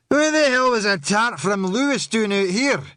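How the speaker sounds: put-on slurring drunk woman; words slurred with drink. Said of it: "Her voice was already slurred".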